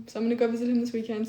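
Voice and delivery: usual speaking voice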